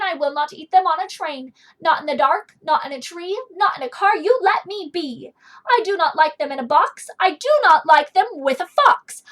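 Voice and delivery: loud, stubborn grumpy guy; grumpy refusal. Acted. Grumpy guy refuses Sam, loud stubborn no. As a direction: bark each no like a cranky grown-up.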